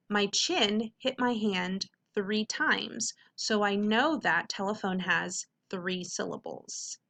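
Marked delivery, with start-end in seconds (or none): none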